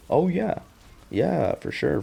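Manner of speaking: high, noncommittal mock agreement. Monster humouring his clingy daughter, high insincere agreement. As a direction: start way up high, humouring her, then sink. Acting father brushing her off.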